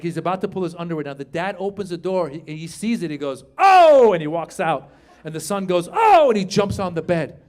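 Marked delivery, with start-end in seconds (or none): 3.6-4.1 loud, high startled "oh!"; shocked yelp. Retold or imitated. TV father walks in on his son, shocked yelp. 5.9-6.3 loud, very high "oh!"; startled yelp. Retold or imitated. TV son caught by his father, startled high yelp.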